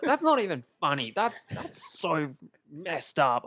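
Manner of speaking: high, indignant mock outrage; imitated anger. Retelling how Tom got mad at Joe, imitated anger. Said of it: "He actually got mad".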